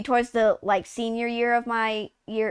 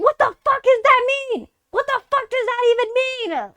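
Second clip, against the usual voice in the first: much higher, incredulous squeal; baffled outburst; 11 semitones higher than usual. Retold or imitated. Player baffled by game slang, high incredulous squeal. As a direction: shriek in total confusion. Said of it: "I'm like".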